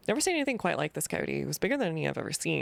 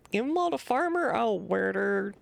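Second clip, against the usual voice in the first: put-on grumpy old farmer; 6 semitones higher than usual. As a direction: warn strangers off your land. Voices the farmer NPC.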